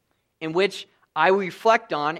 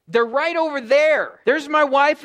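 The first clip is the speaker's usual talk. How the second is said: raised, slow, pointing out the obvious; exasperated husband voiced in the story, a bit raised and slow; 8 semitones higher, 7 dB louder, slower than usual. Preacher voicing himself, exasperated at a friend wanting the photo.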